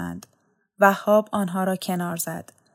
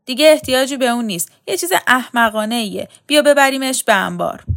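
loud, fast, higher dismissive scolding; scowling order; 4 semitones higher, 6 dB louder than usual. A narrator's reading. Frowning soldier orders the curtain hauled off, loud. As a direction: bark it out, impatient with his nonsense. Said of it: "آتشکار اخم کرد".

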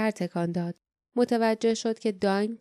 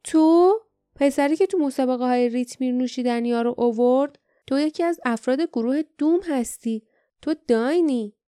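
higher, louder burst of surprised recognition; astonished; 4 semitones higher, 5 dB louder than usual. A narrator's reading. Jasmine recognizes the boy from the games, astonished. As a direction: blurt it out in amazement. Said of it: "جاسمین با تعجب گفت".

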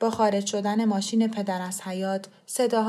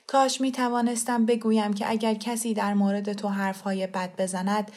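ordinary delivery, nothing marked

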